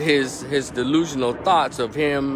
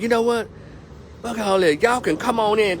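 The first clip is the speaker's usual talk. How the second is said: mad wife; 11 semitones higher than usual. Retold or imitated. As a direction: throw the door open in a rage. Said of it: "she got so mad".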